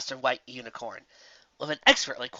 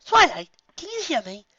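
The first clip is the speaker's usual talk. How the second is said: high, refined lady voice; Rarity on the mic; 13 semitones higher, 4 dB louder than usual. Acted. Rarity calls Twilight on the headset, high and refined. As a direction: ask it daintily.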